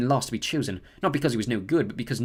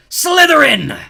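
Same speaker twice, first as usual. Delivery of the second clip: loud, high scream of the house; hat screaming; 15 dB louder, much slower than usual. A narrator's reading. The hat screams its instant verdict, loud and high. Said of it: "when it screamed".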